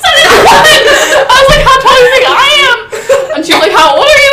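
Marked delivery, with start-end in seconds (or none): 0.0-2.9 very high, louder laughing disbelief; exasperated laughing. Retold or imitated. Retold exasperation at child. 3.7-4.3 high, louder amused quoting; amused quote. Retold or imitated.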